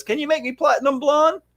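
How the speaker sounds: put-on high-pitched girlish request; salon client girl. Dark-haired girl asking stylist for blonde, high girlish voice. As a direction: put on a high, rising girl's voice. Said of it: "A dark-haired girl comes in and says".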